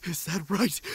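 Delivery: normal talking voice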